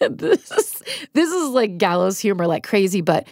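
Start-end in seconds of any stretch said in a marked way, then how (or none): 0.0-1.8 breaking into laughter while talking; high-pitched, helpless giggle through the words; laughing. Real, not acted. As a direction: struggle to get it out through laughter. Breaks up laughing at dark story.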